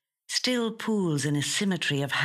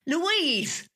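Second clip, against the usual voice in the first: distant raised call of her name; 13 semitones higher than usual. A narrator's reading. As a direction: call her from another room. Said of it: "Her husband's voice calling".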